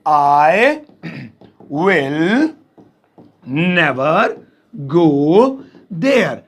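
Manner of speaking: each word set apart, slow. Shown as a demonstration. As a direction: spell it out slowly, word by word. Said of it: "तो इसे English में कहेंगे". (एक-एक शब्द धीरे-धीरे)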